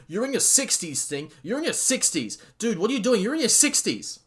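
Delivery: high, loud, incredulous yelling; exasperated fan screaming at a wrestler; mock screaming. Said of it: "I'm screaming".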